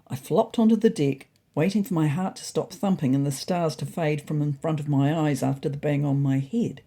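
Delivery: ordinary delivery, nothing marked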